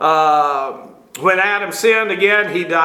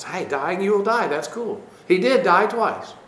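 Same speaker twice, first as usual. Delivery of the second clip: much softer, breezy mock of a goofy teacher; mock naive enthusiasm; 4 dB quieter than usual. Teacher mimicking goofy teachers, softly mocking. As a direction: toss it off like a shallow fan of the idea. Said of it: "goofy ideas that sound good".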